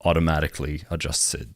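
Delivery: ordinary talk, nothing marked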